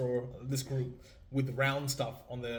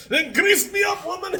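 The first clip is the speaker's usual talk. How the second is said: loud, higher mock Willie bellow; Groundskeeper Willie; 11 dB louder than usual. Host repeating the meme line for his guest, hammed-up Willie yell. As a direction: belt it out as a lightly voiced groundskeeper. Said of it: "That's Willy, basically".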